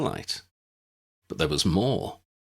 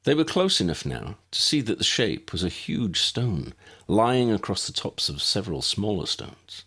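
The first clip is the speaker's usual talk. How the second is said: ordinary delivery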